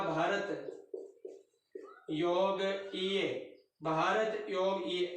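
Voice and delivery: each part spelled out slowly; slow dictation. Shown as a demonstration. Teacher spelling out a word for students, slowly. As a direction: dictate it piece by piece for the class. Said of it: "Bharat योग ईए (syllable-by-syllable dictation)".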